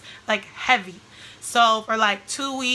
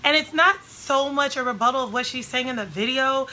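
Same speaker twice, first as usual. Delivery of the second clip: ordinary delivery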